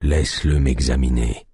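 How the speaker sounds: low, weak command; weak sick chief. A narrator's reading. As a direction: order it feebly from bed.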